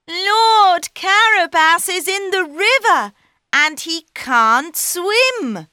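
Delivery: high, loud and urgent; calling out. Acted. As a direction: shout it toward the coach. Cat calls to king.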